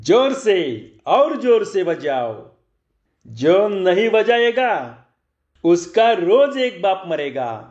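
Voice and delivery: lightly voiced street magician; showman's call. A narrator's reading. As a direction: work up the crowd with drawn-out calls. Magician rousing his audience.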